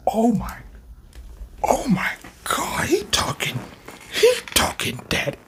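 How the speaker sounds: high whispered half-cry of awe; breathless awe. Real, not acted. Stunned by Yuji's threat, high breathless whisper. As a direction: whisper it like you can't believe it.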